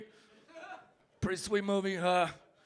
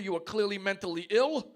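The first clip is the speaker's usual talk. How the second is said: normal talking voice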